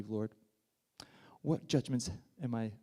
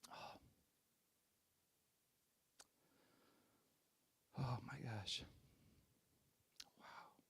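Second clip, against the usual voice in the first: very soft, hushed gasps of awe; barely voiced, with long pauses to recover; collect yourself, almost too moved to speak; overcome with emotion; 16 dB quieter, slower than usual. Real, not acted. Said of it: "I started weeping".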